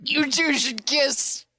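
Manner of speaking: high, whimpering fan plea; yearning fan squeal. Acted. Fan watching two flirting characters, whimpering and yearning. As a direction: whine with pent-up longing. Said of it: "I'm just like".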